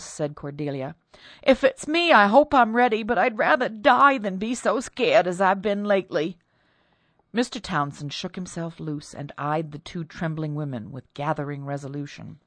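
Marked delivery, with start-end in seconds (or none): 1.4-6.4 high, loud shaken voice; scared woman bracing for death; frightened servant. Acted.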